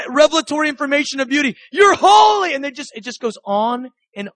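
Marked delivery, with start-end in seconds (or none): 1.7-2.5 high, loud cries of worship with long-drawn words; awed proclamation. Acted. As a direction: proclaim it like an overwhelmed heavenly creature. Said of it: "they begin to proclaim".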